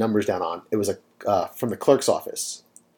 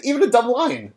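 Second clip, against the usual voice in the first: laughing through the words; high, amused agreement with a laugh; 12 semitones higher than usual. Real, not acted. Laughing along at missed jokes.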